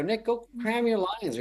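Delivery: high, slow mock brush-off; lightly hammed-up teasing put-down; dismissive retort. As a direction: tell him off, drawn out and high. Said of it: "I go".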